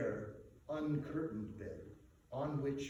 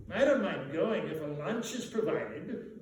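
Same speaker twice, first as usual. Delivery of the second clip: a hint of a greedy gent, slightly higher; lightly voiced gentleman; 3 semitones higher, 6 dB louder than usual. A narrator's reading. Red-faced gentleman joking about lunch, lightly voiced. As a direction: toss it off half joking. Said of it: "observed the red-haired, red-faced gentleman".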